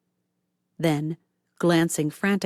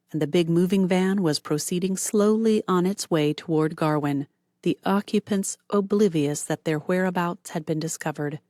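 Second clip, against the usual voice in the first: ordinary delivery, nothing marked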